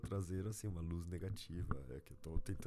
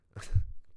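incredulous laugh; 17 dB louder than usual. Real, not acted. Stunned laugh at absurd request.

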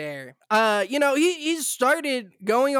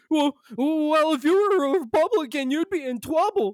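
mock smug conservative; 6 semitones higher than usual.

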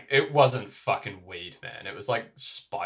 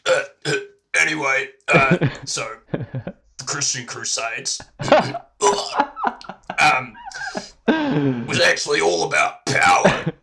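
loud queasy speech broken by gags; imitating someone fighting back vomit; almost vomiting; 4 semitones higher, 8 dB louder than usual. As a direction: lecture through mock burp-retches. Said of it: "almost vomiting, man".

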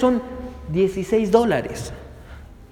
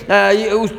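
usual speaking voice

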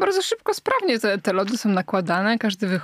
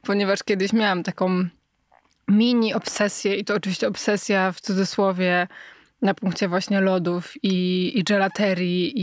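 usual speaking voice

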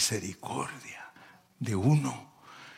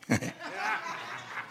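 high burst of laughter; 10 dB quieter than usual. Real, not acted. Laughs at own joke.

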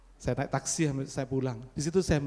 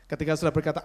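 ordinary delivery

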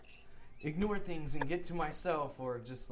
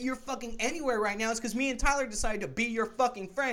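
louder, higher, rattled off fast; fast angry retold rant; 7 semitones higher, 9 dB louder than usual. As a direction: hammer him with reasons, rushing and loud. Retold shouting down the roommate.

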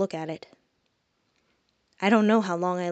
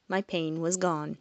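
usual speaking voice

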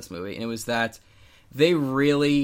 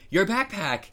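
Buzz Lightyear yell; 8 semitones higher than usual. Retold or imitated. Retelling a toy yelling at the human, mock yell. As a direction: imitate a yelled line, pitched well up. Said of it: "Buzz Lightyear literally yells at the human".

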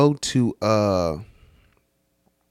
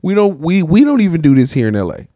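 worked-up rant; 7 semitones higher, 7 dB louder than usual. Real, not acted. Ranting comparison with LA.

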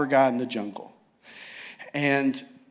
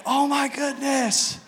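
stunned gasp; 9 semitones higher, 3 dB quieter, slower than usual. Retold or imitated. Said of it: "and is like".

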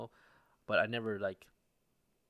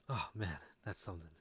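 touched sigh; 4 semitones lower, 6 dB quieter than usual. Retold or imitated.

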